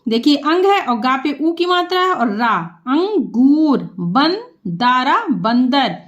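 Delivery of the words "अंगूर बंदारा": Bhandara drawn out, each syllable apart; slow sounding out. Shown as a demonstration. Teacher to pupils, breaking Bhandara into parts.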